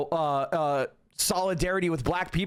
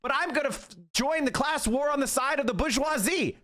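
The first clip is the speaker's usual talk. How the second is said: mock Pete quote; 7 semitones higher than usual. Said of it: "He's saying".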